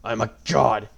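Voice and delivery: booming, mocking god voice; gloating villain. A narrator's reading. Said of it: "Grima laughed".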